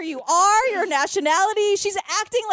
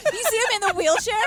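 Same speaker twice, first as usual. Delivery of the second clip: ordinary delivery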